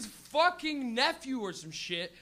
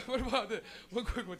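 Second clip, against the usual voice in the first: usual speaking voice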